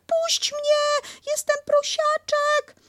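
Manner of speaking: wriggling little pig; Piglet protesting. Acted.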